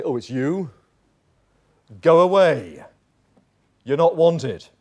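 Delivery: curt, loud slam-the-door Go away; dismissive brush-off. Acted. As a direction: turn him away coldly at the door. Door shut on Jesus.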